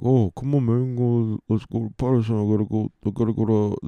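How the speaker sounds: a light take on his pushy younger brother; imitating his brother. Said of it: "this is how my brother talks".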